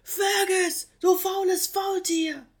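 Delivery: high, loud furious yell; angry scream. Acted. Koala lady screaming up at the lazy sloth, furious. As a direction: scream his name at him in rage. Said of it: "einem lauten Schrei".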